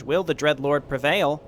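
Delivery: usual speaking voice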